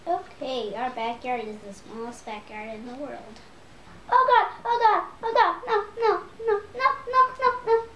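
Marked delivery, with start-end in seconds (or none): 4.1-7.8 high panicked no no no. Real, not acted. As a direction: panic as danger hits. Something attacks in game.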